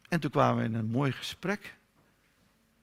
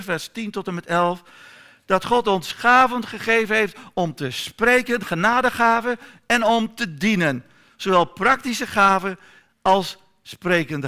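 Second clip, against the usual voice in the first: loud, high, fervent sermon delivery; preaching at full force; 5 semitones higher, 9 dB louder than usual. Real, not acted. Preacher rousing his congregation about God's gifts, full-voiced. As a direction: preach it out to the back rows.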